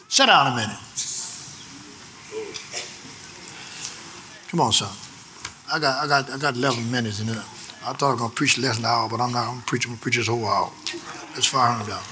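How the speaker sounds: low aside. Real, not acted. Preacher aside to the church, low and quick. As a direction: drop out of the sermon and chat quietly.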